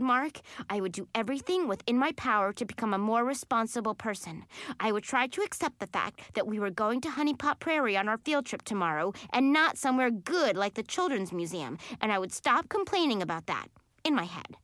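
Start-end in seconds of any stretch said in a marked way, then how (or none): none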